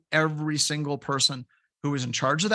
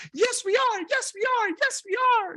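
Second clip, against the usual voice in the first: put-on very high, squeaky kid voice; imitating kids chiming in; excited kids. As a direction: pipe up like eager children, over and over. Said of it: "my kids immediately responded with".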